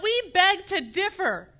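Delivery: slow, high-pitched defiant cry; rallying shout. Acted. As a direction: call it out for a whole community, stretched. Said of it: "stand up and shout".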